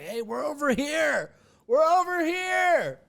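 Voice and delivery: high, loud imitated holler; calling friends over. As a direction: call out across a parking lot, stretching it. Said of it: "trying to wave my friends".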